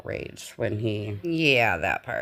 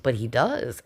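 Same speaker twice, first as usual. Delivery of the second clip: ordinary delivery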